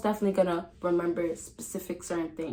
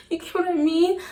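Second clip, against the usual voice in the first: laughing; 11 semitones higher, 4 dB louder than usual. Real, not acted. Laughing after the squeak.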